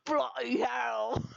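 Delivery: mock a cheap Cockney bloke, laughing; bad British impression. Friends doing shitty Dorian impressions, mock British accent. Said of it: "really shitty impressions of Dorian".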